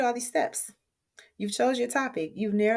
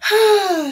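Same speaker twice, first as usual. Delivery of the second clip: a big loud sigh of relief; loud tired sigh; 11 semitones higher, 10 dB louder than usual. Real, not acted. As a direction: blow out a long sigh, job done. Relief after finishing the video.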